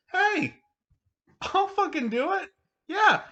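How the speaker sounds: very high-pitched mock-eager offer; eager volunteer. Voicing scientist's eager offer.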